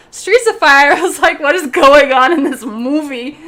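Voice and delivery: loud, incredulous recap of a film reaction; laughing bewilderment, at high volume; baffled outburst. Retold or imitated.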